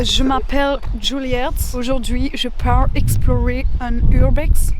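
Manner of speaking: French spoken slowly with an English accent; slow French with a put-on English accent. Shown as a demonstration. Vlogger demos the car joke to viewers, slow English-accented French. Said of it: "avec un accent, euh, anglais".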